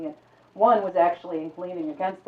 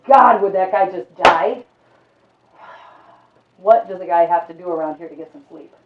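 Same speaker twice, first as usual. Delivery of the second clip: exasperated grumble; 11 dB louder than usual. Acted. Kept awake by snoring.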